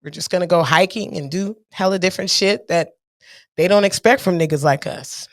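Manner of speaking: ordinary talk, nothing marked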